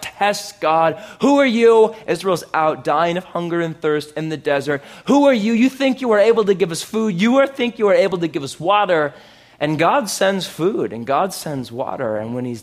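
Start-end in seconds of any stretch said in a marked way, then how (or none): 1.2-1.9 loud, higher-pitched challenge; challenging. Acted. Israel arguing with God in the desert, challenging. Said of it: "argues with God". 5.1-9.1 high, loud, rapid-fire challenge; challenging. Acted. Starving Israel testing God in the desert, challenging. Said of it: "argues with God".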